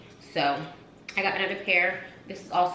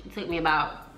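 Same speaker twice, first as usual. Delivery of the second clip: usual speaking voice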